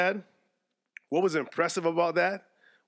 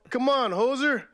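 hammed-up Canadian, higher and a bit louder; Canadian accent; 8 semitones higher, 4 dB louder, slower than usual. Host razzing a player in a hammed-up Canadian accent. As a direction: razz him like a Canadian hoser. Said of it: "how about".

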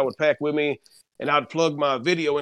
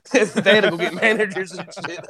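laughing; 4 semitones higher than usual. Real, not acted. Telling the McDonald's sweet tea story.

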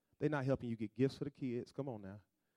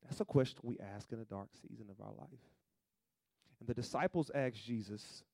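ordinary talk, nothing marked

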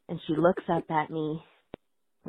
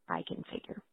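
tiny joking whisper; whispered aside; 12 semitones lower, 15 dB quieter than usual. Real, not acted. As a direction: whisper it under your breath. Joking aside after puke story.

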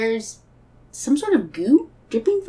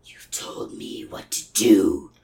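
slow, whispery monster growl far below the usual voice; sinister symbiote; 3 dB quieter, much slower than usual. Acted. Honey symbiote grabs Rabbit by the neck, menacing. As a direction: draw out the menace in a very low whisper.

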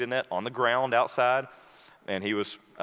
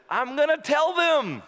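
very high, louder and slow; joyful call; 5 dB louder, slower than usual. Retold or imitated. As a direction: call it out proudly. Friend calls back, joyful.

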